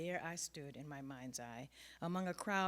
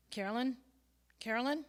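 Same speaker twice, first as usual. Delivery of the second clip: calling out on the phone; 7 semitones higher, 10 dB louder than usual. Acted.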